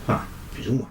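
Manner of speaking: a soft whispered aside. Real, not acted. The host whispers a sly aside to viewers. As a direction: slip it in under your breath.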